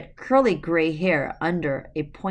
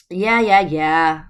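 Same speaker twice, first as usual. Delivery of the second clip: loud, dismissive yeah yeah; impatient yeah yeah; 10 dB louder, much slower than usual. A narrator's reading. Mother Goose impatiently cutting off Xanthus's introduction, loud. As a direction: wave off the introduction.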